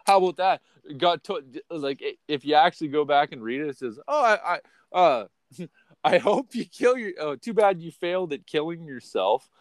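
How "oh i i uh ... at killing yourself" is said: mocking quote. Mockingly quotes the nasty message.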